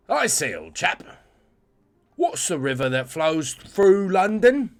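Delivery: starts posh English and slides into Cockney. Acted. Quizmaster asking chat the London river in posh-then-Cockney English. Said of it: "I went proper, and then I went Cockney".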